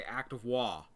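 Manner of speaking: imitated accent, WA with the R dropped; Boston accent. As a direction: say WA for war like a Bostonian. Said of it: "they said it with an accent".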